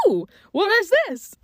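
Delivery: high, loud delighted gasp; thrilled discovery; excited. Retold or imitated. Delighted find in store.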